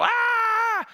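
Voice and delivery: high, sudden mock shriek of pain; little girl scream. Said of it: "I'm screaming, you know, like a little girl".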